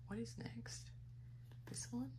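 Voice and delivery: whispering to self. Real, not acted. Muttering to herself.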